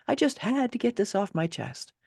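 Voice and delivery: ordinary delivery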